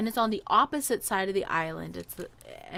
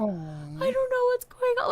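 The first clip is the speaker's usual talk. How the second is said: much higher little puppy voice; voicing the puppy. Speaking for the confused rescued puppy in a high squeaky voice. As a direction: give the scared dog a tiny high voice. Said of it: "He's like".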